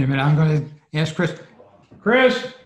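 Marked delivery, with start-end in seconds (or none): 2.0-2.5 high, loud call across the room; shouting for help with the screen. Real, not acted. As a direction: call his name to get his attention. Calling to helper again.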